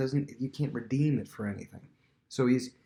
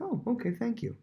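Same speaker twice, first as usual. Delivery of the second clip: polite thanks; 12 semitones higher than usual. Retold or imitated. Polite reply to flattery.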